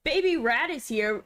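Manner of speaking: usual speaking voice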